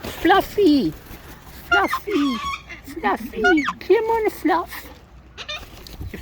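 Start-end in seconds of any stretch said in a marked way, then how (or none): 0.0-4.9 loud high sing-song calls of Fluffy; high calling out. Real, not acted. Calling Fluffy the bird to come over, loud high sing-song.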